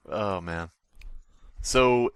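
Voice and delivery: normal talking voice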